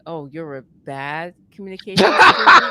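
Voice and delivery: loud, mocking professor echoing her words; teasing loud quote. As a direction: call it out across the room with a tease. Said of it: "how he said it was like".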